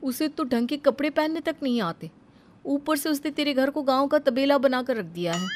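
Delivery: mock the village girl mildly; lightly voiced gossiping auntie. Neighbour woman telling Kavya her bhabhi is a bumpkin, lightly voiced sneer.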